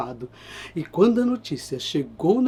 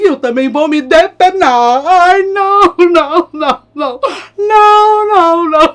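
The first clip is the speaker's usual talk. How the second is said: high, loud crying no; hammed-up sobbing; mock wailing; 10 semitones higher, 9 dB louder than usual. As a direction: wail no over and over. Bird laments.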